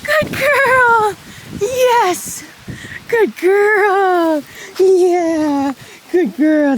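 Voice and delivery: very high, loud excited praise; squeaky praising a dog. Real, not acted. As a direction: gush over her like a puppy. Rewarding horse after recall.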